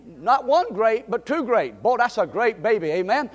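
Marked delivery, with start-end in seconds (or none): none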